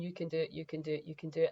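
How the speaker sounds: soft, quiet coaxing; gentle encouragement. Retold or imitated. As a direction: coax them gently, again and again. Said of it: "slowly chip and chip and chip and".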